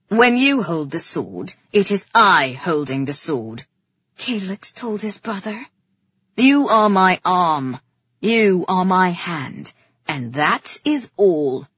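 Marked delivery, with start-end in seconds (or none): 0.1-3.6 low, louder, authoritative king; commanding king. A narrator's reading. King asserting power over his brother, commanding. 6.4-11.7 loud, slow, weighty pronouncement; domineering. A narrator's reading. King putting his brother in his place, domineering.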